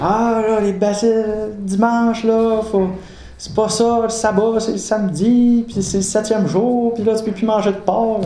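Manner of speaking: voix de père haut perchée qui fait la leçon; père sermonneur. Retold or imitated. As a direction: joue le père qui débite les règles, voix montée. Père explique le sabbat. (imitation du père, voix plus aiguë)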